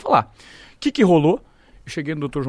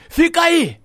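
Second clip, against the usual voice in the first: high, loud barked order; mimicked shouted command; retold boss's shout; 7 dB louder, much slower than usual. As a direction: yell it like a boss on the phone. Retold boss ordering him to stay.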